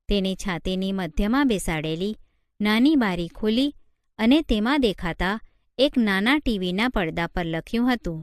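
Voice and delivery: usual speaking voice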